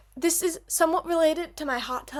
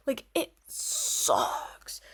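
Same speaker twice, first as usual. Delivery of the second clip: slow whispered put-down; whispered verdict; 5 dB quieter, much slower than usual. Real, not acted. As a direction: whisper it slowly and dismissively. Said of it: "dismissing the band's music".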